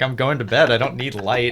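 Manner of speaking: mock huffy. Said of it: "It's like".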